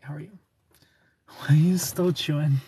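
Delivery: ordinary talk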